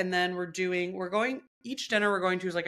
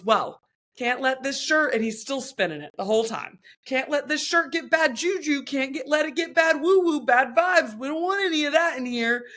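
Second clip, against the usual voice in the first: lightly voiced hyped instructor, higher and a bit louder; playing the overexcited spin coach; mock gym instructor; 6 semitones higher, 5 dB louder than usual. Imitating the instructor to friend, a hint of hype. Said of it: "Then he goes".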